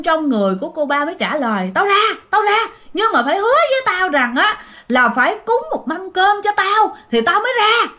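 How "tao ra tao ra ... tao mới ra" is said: much higher, pleading ghost; high ghost voice haggling to leave. Retold or imitated. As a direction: bargain for a meal, pitched up.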